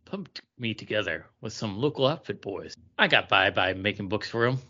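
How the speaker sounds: low, hushed sultry reading; Penthouse letter read. Acted. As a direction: read it like a steamy letter page. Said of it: "read it like it was a Penthouse letter".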